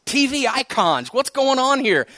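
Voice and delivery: high, loud startled double-take; shocked, like it just hit you; wait, am I getting old; sudden alarmed realization. Retold or imitated. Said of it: "And I was like".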